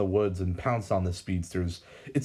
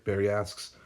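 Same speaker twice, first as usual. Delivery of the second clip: plain reading voice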